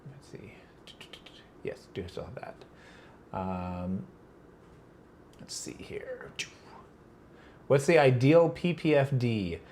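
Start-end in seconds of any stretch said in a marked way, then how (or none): 0.1-0.5 barely voiced whisper of "let's see"; whispered to herself. Real, not acted. Reading comments to herself. 1.6-6.3 soft half-whispered mumble; muttering to herself. Real, not acted. Reading comments to herself.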